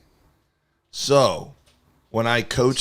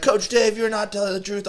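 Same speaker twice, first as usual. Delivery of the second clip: put-on high, whiny kid voice; mocking kids; 11 semitones higher than usual. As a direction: squeak it like a doubting kid. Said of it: "they're like".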